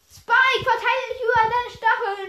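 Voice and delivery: high, loud reprimand; loud scolding. Acted. Telling Spike off about his spines, loud scolding.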